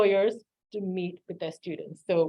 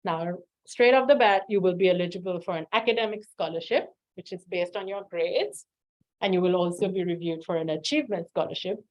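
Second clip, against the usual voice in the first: ordinary talk, nothing marked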